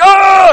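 very loud, high "oh" yell; sudden full-volume shriek in pretence; mock demon scream. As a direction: blast out a hammed-up cry. Acts out the demons' outburst.

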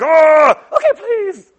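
loud high wail, then plea; shrieking, exaggerated pleading; mock begging. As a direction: plead like a panicked demon, hammed up. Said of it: "the demons begged him".

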